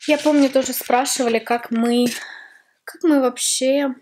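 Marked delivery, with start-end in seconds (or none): none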